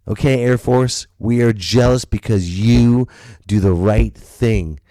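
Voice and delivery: ordinary talk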